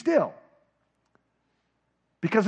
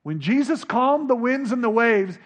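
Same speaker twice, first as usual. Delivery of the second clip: normal talking voice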